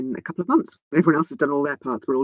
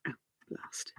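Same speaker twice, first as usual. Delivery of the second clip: quiet muttering; 12 dB quieter than usual. Real, not acted. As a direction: mutter it under your breath. Quiet muttering while editing.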